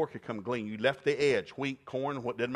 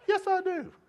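put-on much higher, sweet girlish reply; Ruth's voice; 4 dB louder than usual. Ruth answering Boaz's question, high falsetto voice. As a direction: squeak it out like a shy young woman.

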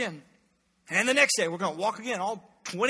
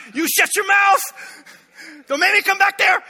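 much higher, louder furious shout; parent yelling; 10 semitones higher, 9 dB louder than usual. Retold or imitated. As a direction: snap at the kids in the back seat. Parent yelling at kids in car.

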